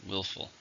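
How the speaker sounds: "Willful" with WILL stressed; stress on first syllable. Shown as a demonstration. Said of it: "Stress on the first".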